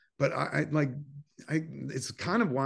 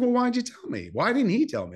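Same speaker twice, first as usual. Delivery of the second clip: high, loud and exasperated; pressing his mom on why she told him; exasperated outburst; 10 semitones higher, 4 dB louder than usual. Retold or imitated. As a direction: fling the question back at her.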